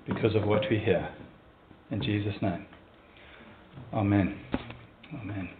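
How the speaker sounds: soft, low, measured prayer. Real, not acted. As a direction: pray quietly, leaving long pauses between phrases. Leads a prayer.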